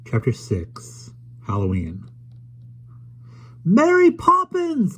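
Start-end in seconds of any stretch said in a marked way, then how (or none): 3.6-5.0 high, slow call across the park. A narrator's reading. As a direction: call after her, drawn out. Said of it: "called Michael".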